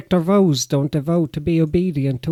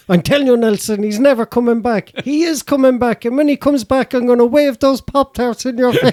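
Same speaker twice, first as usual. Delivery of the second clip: raised, higher voice, building as she goes; scolding cartoon mother; 6 semitones higher, 5 dB louder than usual. Mother scolds son about absent father, imitated raised voice. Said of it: "And she goes".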